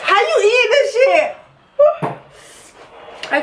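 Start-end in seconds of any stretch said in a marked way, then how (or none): 0.0-1.4 laughing. Real, not acted. Laughing at spicy food eater.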